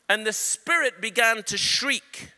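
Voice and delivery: ordinary talk, nothing marked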